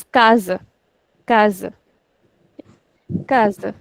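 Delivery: "casa" as KA-za, first syllable stressed; teacher modelling "casa" with second-last syllable stressed. Shown as a demonstration. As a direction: lean on the "ca" of "casa", let the ending fall. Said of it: "we will put stress on this one".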